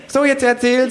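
hohe Figurenstimme, auffordernd; hoch zum Erzählen drängen; Känguru. Acted. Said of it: "sagt das Känguru". (hohe Känguru-Stimme)

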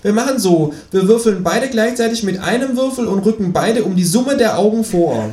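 high, indignant kangaroo protest; high, offended kangaroo. Acted. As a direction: whine like a cheated cartoon kangaroo. Kangaroo figure voiced.

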